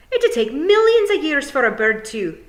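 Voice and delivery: high, loud, cut off; loud protest. Acted. Fionn arguing with the pixies, loud. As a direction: keep protesting over the others.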